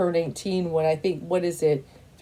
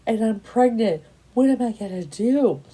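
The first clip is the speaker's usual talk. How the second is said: imitated tearful plea, pitched higher; retold crying; 5 semitones higher than usual. Quoting a pregnant woman in her fifties, mock tearful. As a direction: act out her distress in a raised pitch, no sobs. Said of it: "she's crying".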